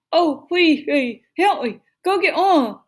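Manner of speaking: high, loud garbled pleading; mouth stuck. A narrator's reading. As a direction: yell for help with lips stuck fast. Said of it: "like he had something in his mouth".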